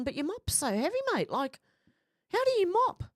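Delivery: incredulous, climbing to a high-pitched finish; high, comically puzzled question; mock bafflement. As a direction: act out baffled gym guys, pitch shooting up. Retold gym guys' baffled reaction.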